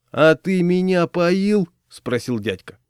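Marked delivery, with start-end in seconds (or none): 0.1-1.7 put-on slow, high, loud drunken challenge; slurring-slow indignant question; aggrieved drunk. A narrator's reading. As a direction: draw it out as an offended drunk. Drunk man challenges his accuser.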